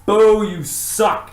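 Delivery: slow, high, loud booing heckle; drunk heckler shouting. Retold or imitated. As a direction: boo him off the stage. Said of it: "and he just goes".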